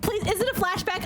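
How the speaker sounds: high, urgent begging; desperate pleading. Real, not acted. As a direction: beg repeatedly, pitch jumping up. Begs game for a flashback.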